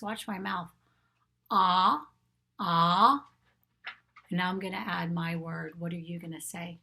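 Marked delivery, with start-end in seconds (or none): 1.5-3.3 loud, open ah as in octopus; short O sound. Shown as a demonstration. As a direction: punch out ah twice, separately. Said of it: "short sound for the letter O goes like this".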